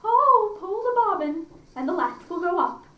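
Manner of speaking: high, loud calling out from bed; cried out. Acted. Grandmother in bed crying out to the door, loud and high. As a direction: call loudly through the door. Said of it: "cried out".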